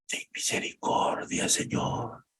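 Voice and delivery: a soft whispered cry for mercy; breathy whispered prayer of a grieving king; whispered plea. Acted. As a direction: whisper the prayer like David in the night. David crying out to God.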